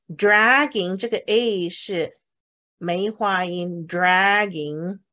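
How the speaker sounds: dragging slowly, open a vowel; teacher slowly models dragging. Shown as a demonstration.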